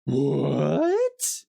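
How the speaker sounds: drawn-out "what" swooping up high at the end; long, rising gasp of disbelief in the word; astonished. Real, not acted. Streamer learns of a VR version of the game, astonished.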